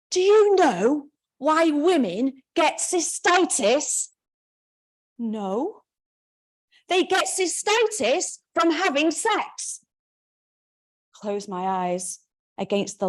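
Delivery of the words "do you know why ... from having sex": accusing mother. Acted. Mother accusing daughter on phone.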